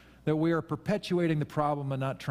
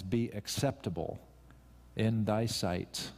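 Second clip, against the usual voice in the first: soft prayerful recital; 4 semitones lower, 6 dB quieter, slower than usual. Shown as a demonstration. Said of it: "if that is our tone".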